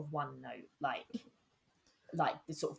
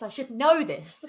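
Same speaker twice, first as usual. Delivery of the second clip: laughing; 13 semitones higher, 10 dB louder, slower than usual. Real, not acted. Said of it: "self-mocking joke".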